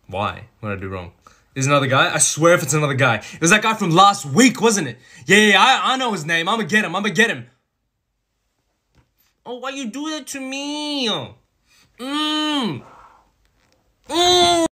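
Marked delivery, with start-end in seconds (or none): 1.5-7.5 loud, high jealous rant; jealous outburst. Acted. Jealous outburst about another man. 9.4-14.7 put-on very high, babyish whimper; pouty whine.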